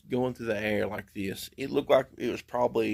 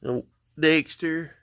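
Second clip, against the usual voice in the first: scolding dog; 6 semitones higher, 4 dB louder, slower than usual. Real, not acted.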